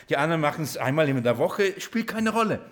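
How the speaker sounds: ordinary talk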